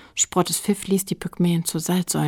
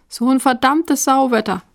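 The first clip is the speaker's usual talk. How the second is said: higher, louder cursing at the weather; grumbling; 7 semitones higher, 7 dB louder than usual. A narrator's reading. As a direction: complain loudly about the foul weather. Grumbling boy curses the weather. (lautes, genervtes Schimpfen)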